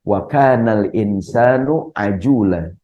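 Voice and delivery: slow recited Arabic verse; Quran recitation. Shown as a demonstration. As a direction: intone the verse slowly, like reciting. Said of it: "Di Quran Allah mengatakan".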